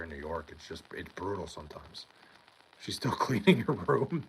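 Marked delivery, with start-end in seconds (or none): 2.8-4.2 laughing. Real, not acted. Reading a funny chat question.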